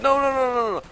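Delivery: high, loud run of no; real alarm as things go wrong; shrill repeated no; panicked no. Real, not acted. Panic under attack.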